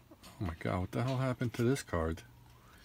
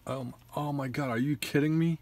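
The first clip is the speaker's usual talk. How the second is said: outraged disbelief; 6 semitones higher than usual. Real, not acted. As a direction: cry out in real dismay at a ruined card. Ruined top card in pack.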